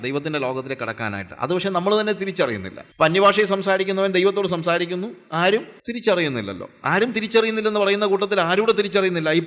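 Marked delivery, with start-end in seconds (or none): none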